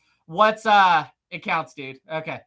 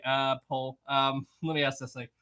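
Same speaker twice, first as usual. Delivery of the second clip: usual speaking voice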